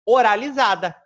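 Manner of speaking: ORALIZADA punched out loud; emphatic repeat. Shown as a demonstration. Teacher names the mouth-only sound, loud emphatic ORALIZADA. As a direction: hit Oralizada hard as the key term. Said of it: "uma palavra que é completamente oralizada".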